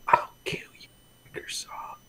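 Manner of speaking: eerie whispered threat. Retold or imitated. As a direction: hiss the threat under your breath. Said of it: "everyone's like".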